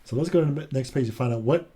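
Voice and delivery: usual speaking voice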